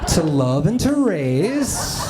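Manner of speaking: slow, solemn school recital, laughing through it; slow, mock-solemn recital. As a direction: stretch it out like a catechism lesson. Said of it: "explained to me like this".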